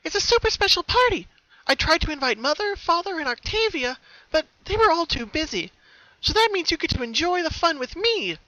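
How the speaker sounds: gushing about the party; bubbly excitement. Acted. Pinky to her sister on the way, bubbly.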